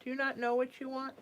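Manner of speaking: ordinary delivery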